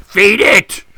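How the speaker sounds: loud, high "Feed it!" as an order; laughing mock Scottish order; mock command. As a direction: bark it like a command. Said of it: "almost like a command".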